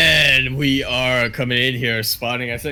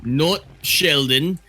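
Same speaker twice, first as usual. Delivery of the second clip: loud Aussie caster intro; Aussie accent; 3 dB louder than usual. Pretend. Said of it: "he just went full Aussie on me".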